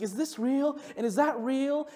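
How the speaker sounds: high-pitched, wide-eyed questioning; imitated child's wonder. Five-year-old asking dad about every ride, imitated wonder. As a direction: ask like an awestruck small child. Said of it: "this sense of wonder".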